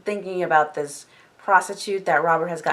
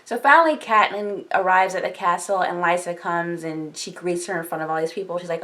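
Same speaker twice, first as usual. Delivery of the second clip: ordinary delivery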